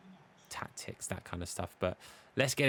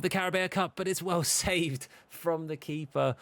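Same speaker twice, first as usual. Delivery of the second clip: excited; 9 semitones higher than usual. Real, not acted. Penalty saved, laughing commentary.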